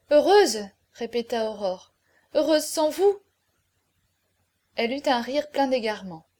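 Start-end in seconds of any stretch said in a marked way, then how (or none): 0.1-0.6 higher, louder exclamation of disbelief; distraught echo. A narrator's reading. Aurore echoes his word in distress. 2.3-3.2 higher pitch, rising incredulous question; distraught disbelief. A narrator's reading. Distraught Aurore challenges him.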